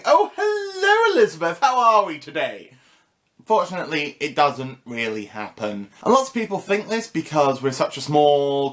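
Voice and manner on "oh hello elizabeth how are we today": gushing chummy greeting. Pretend. Greeting the Queen as a Facebook buddy, mock-chummy. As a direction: pretend to greet a royal buddy, voice swooping up high. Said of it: "and just going".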